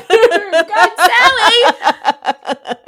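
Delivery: high, loud laughing cry of delight; real laughing outburst. Real, not acted. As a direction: burst out laughing, overwhelmed. Overcome laughing at sweet story.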